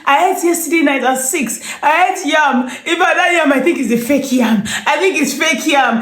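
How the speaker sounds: high, loud insistent grumble; insistent complaining friend. Retold or imitated. Friend on the phone blaming the fake yam, high and insistent. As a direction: insist on it like you've been poisoned.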